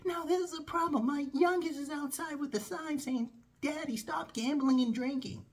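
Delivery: high-pitched frantic complaint. Retold or imitated. Boss with family and intervention outside, worked up. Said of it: "lower your voice".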